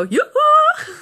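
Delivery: high, loud drawn-out yoo-hoo; family calling back. Retold or imitated. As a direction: answer like a whole cheery family. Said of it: "And they all go".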